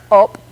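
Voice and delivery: KOP with a popped ejective K. Shown as a demonstration. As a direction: start KOP with an extra catch on the K. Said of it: "this is an ejective type of K".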